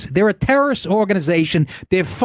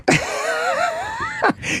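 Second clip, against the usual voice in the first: long, high-pitched helpless laughter; high squealing laugh; 4 dB quieter than usual. Real, not acted. Cornered guest cracks up mid-argument, squealing laugh.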